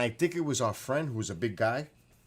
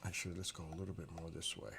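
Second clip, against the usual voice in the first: quiet aside; 5 semitones lower, 10 dB quieter, faster than usual. Real, not acted. Murmured aside from afar.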